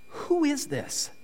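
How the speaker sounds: high, soft, drawn-out question; puzzled. Acted. Man on the phone unsure who's calling, puzzled. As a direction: feel out an unknown caller, slowly and softly.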